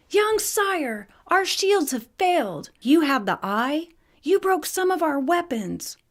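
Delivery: high, urgent voice of a worried guard. Acted. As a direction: voice the palace guard high and alarmed.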